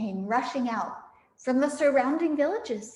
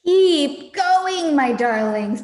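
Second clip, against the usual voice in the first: high, loud breathless urging; panting Mister Fox; 4 semitones higher, 9 dB louder, slower than usual. Acted. Mister Fox panting to his family while digging. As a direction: push them on out of breath. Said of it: "panted Mister Fox".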